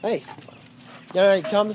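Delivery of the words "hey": normal talking voice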